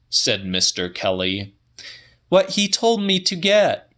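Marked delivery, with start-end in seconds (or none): 2.3-3.9 voiced much higher as the woman; Celia's voice. A narrator's reading. Woman telling the old man her news, higher voice. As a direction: lift the pitch well up for her lines.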